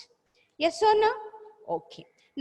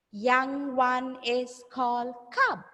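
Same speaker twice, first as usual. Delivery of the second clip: slow, evenly spaced teacher modelling; slow repeat-after-me; slower than usual. Shown as a demonstration. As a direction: say it word by word for pupils. Model sentence for pupils to repeat.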